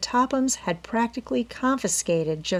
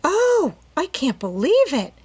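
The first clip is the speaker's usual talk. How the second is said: much higher, louder astonished cry; overwhelmed heir hearing of her windfall; gasping in disbelief; 10 semitones higher, 6 dB louder than usual. A narrator's reading. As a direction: burst out in stunned delight. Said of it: "Allie gasped".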